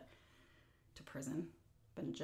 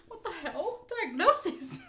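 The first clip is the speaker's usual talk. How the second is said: high, loud disbelieving yelp; shocked cry; 12 semitones higher, 8 dB louder, slower than usual. Retold or imitated. Stunned patient shouting back at the doctor over paperwork. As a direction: blurt it out in shock, pitched up.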